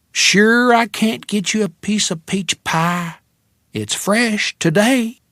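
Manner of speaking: put-on thick Texas accent, sugary. A narrator's reading. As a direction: stretch it out, sweet Texas diner waitress. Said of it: "in a Texas accent that was thick enough to cut".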